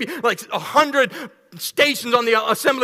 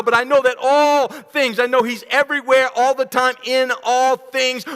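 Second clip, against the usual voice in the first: louder, higher preaching with rising fervour; building preacher; 3 semitones higher, 4 dB louder than usual. Acted. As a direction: preach it out to the congregation.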